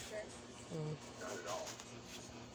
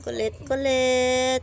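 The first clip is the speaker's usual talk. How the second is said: high, loud and slow, stretching each kulit; drawn-out high call; 5 semitones higher, 10 dB louder than usual. Real, not acted. Calling the pet loudly to make it look. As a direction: coax it out slowly.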